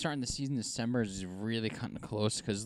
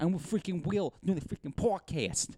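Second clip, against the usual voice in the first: higher, playful mock New York accent; a light New York accent for the podcast joke; 7 semitones higher than usual. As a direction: keep up the New York bit. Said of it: "in a New York accent".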